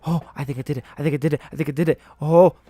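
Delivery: loud, repeated burst of relief; excited disbelief. Real, not acted. Player realizing he finished the game, loud excited relief. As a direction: blurt it out loud, over and over.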